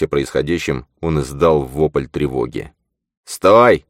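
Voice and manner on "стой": loud, high yell to stop him. A narrator's reading. Zane yells at the panicking shaman to stop, loud. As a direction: bellow at the fleeing man. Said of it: "заорал на него Зейн".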